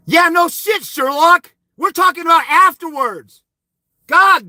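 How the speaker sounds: high, sneering retort; sarcastic outburst. Real, not acted. Mocking the commenter's obvious answer, high sarcastic retort. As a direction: snap it back mockingly at him.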